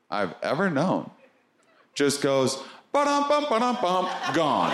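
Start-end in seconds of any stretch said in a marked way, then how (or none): 2.9-4.3 playful sound effect. Retold or imitated. Imitated sound of world vanishing.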